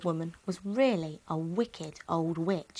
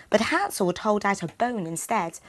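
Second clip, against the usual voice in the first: ordinary delivery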